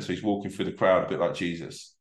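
ordinary talk

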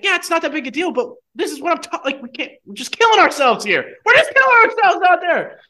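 high, loud exasperated outburst building up; freaking out. Retold or imitated. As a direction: yell it as the team blows it, pitch way up. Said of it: "admittedly do kinda have a freak out".

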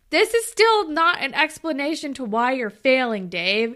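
loud exasperated telling-off; a loud, fed-up telling-off; mock scolding. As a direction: chew him out in frustration. Scolding Dave in mock frustration.

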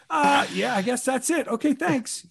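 high, uncertain mock agreement; flustered acceptance. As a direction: act out giving in, unsure. Acts out flustered actor giving in.

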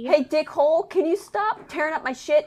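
high, exasperated telling-off; exasperated scolding. Real, not acted. Podcaster to misbehaving pet tearing things up, exasperated scolding.

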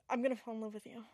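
dropped to a soft, dreamy voice; soft dreamy love confession. Acted. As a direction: confess it softly, half dreaming.

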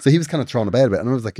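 ordinary delivery

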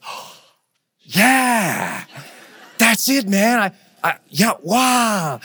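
a stunned, drawn-out yeah, wow; awed realization. Retold or imitated. Client admits it, awed and stunned. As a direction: let the truth land on you.

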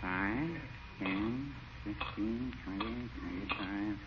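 soft murmured tally of coins; counting under breath. Acted. Shopkeeper counting his cash softly, half ignoring his friend. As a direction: count the till quietly to yourself. Peavy counts money while closing up.

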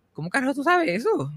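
high, stunned question to mom; shocked question. Retold or imitated. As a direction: ask it caught off guard. Quote of own shocked reply.

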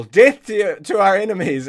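loud, high re-enacted war cry; big mock-heroic battle shout; yelled battle cry. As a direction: yell it as a charging fighter would.

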